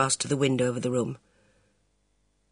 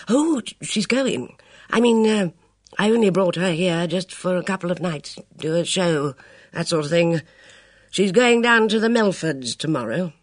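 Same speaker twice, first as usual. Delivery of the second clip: put-on higher, halting, embarrassed old gentleman; flustered elderly officer, apologetic; flustered old colonel; 5 semitones higher, 4 dB louder than usual. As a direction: stammer it out like a caught-out colonel. Luscombe reassures nervously.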